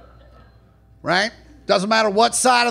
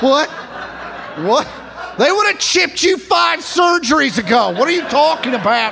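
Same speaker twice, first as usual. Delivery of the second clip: incredulous; 5 semitones higher than usual. Retold or imitated. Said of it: "I'm like".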